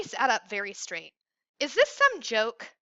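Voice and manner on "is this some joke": slow, pressing demand, a little raised. A narrator's reading. Andy demanding the caller say who he is, pressing. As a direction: push him for an answer. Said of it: "he demanded".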